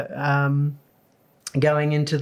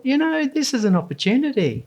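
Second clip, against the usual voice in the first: high excited inner thought; inspired; 9 semitones higher than usual. Retold or imitated. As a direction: seize the moment brightly. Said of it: "And I just thought".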